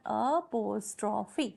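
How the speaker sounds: "अपोस्ट्रॉफी" broken into a, pos, tro, fee; divided into syllables. Shown as a demonstration. Teacher divides a word for students, "अपोस्ट्रॉफी" syllable by syllable. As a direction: say "अपोस्ट्रॉफी" in four drawn-out parts. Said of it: "अलग-अलग parts में divide करेंगे".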